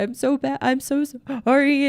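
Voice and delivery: high-pitched, hammed-up tearful apology; mock crying. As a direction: wail it as if bursting into tears. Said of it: "start crying of like".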